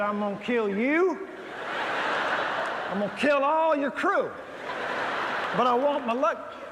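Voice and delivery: loud, high, hammed-up threat; a preacher hamming up a rage at the ship's bursar; mock furious threat. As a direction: bellow it like a furious passenger. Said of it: "I said".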